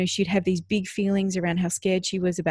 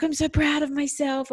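high-pitched imitation of a pleased child; proud child; 9 semitones higher than usual. Daughter after her first lesson, imitated pride. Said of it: "She was like".

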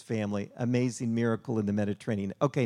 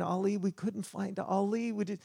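high-pitched retold anguish over a missing child; mildly acted tearful cry, pitched well up; imitated crying; 8 semitones higher than usual. Parents crying to the fisherman over their lost son, imitated tearful voice. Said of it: "they're just crying".

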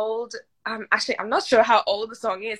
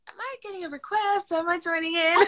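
higher, quick puzzled questions; confused inner voice; 10 semitones higher, faster than usual. Retold or imitated. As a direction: replay your own flustered confusion, higher and hurried. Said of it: "and I was like".

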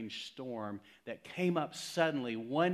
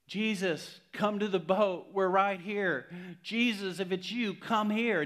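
higher, louder, pleading; mock frantic plea; 7 semitones higher, 5 dB louder than usual. Peter pleading with Jesus to come to the boat, mock frantic plea. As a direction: beg him to come to the boat. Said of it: "Why didn't he just say".

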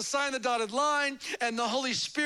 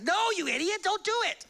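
higher, scolding no; wife stops husband, higher and scolding; mock exasperated wife; 8 semitones higher than usual. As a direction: stop him from signing. Wife stopping him.

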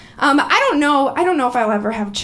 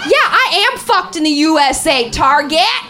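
loud, raised-pitch proclamation; defiant shout; 5 semitones higher, 6 dB louder than usual. Retold or imitated. Comic agreeing with the autocorrected text, defiant shouting. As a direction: belt it out as a defiant declaration.

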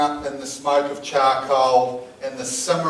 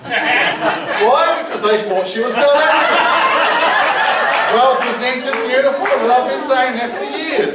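loud, high mock exasperation; raised-voice comic I-told-you-so; triumphant vindication; 9 semitones higher, 6 dB louder than usual. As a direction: exclaim it over the audience's laughter. Comic vindication after the punchline.

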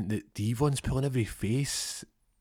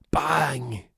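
high, loud shouted bang; sound effect bang; 6 semitones higher, 5 dB louder than usual. Retold or imitated. Kick landing in Devon's face, shouted bang. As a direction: punch the impact out.

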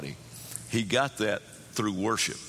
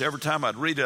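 normal talking voice

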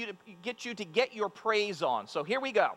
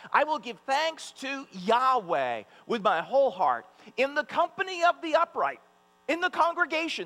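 high, loud fervent psalm reading; preaching scripture reading; 7 semitones higher, 4 dB louder than usual. Acted. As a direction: proclaim the psalm with joy. Reads psalm with fervour.

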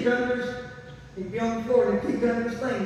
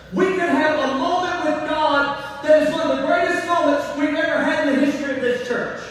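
raised, ringing preacher's cadence; full-force preaching; 5 semitones higher than usual. Acted. Pastor warns church about emotional faith, ringing sing-song preaching. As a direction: build the congregation up, half singing.

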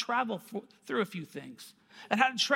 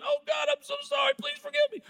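very high, wailing mock apology; exaggerated tearful pleading; hammed-up repenting; faster than usual. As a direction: beg forgiveness in a comic high wail. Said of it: "I called up my wife and I repented".

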